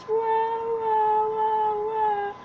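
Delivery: put-on childlike wail; mock crying wah. A narrator's reading. Lost bunny wailing in the dark. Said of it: "She burst into tears".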